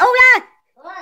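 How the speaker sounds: high, loud call; hailing someone far off. Acted. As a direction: shout the name across a distance. Calling out again.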